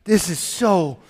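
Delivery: loud, fervent sermon voice; full-force preaching. Acted. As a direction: preach it out to the congregation.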